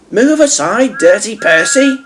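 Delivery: high, teasing call; Thomas calling. A narrator's reading. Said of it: "called Thomas".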